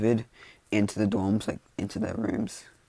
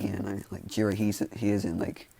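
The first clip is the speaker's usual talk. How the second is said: usual speaking voice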